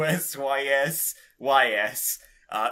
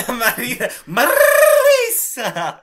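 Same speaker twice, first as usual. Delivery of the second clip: shouted high and loud; excited shout; 11 semitones higher, 13 dB louder, slower than usual. Real, not acted. Friend cheering the character's name with a loud, excited shout. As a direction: yell the name out in glee.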